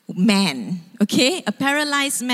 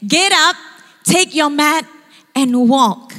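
raised, ringing command in separate phrases; loud command; 5 semitones higher, 5 dB louder than usual. Retold or imitated. Jesus tells the paralyzed man to walk, commanding. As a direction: order it loudly, pausing between each phrase.

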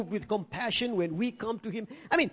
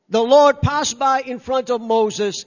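ordinary delivery

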